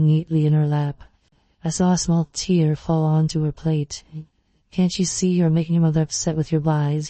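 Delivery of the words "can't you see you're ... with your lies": usual speaking voice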